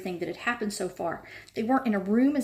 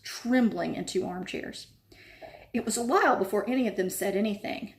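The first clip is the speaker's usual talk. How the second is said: plain reading voice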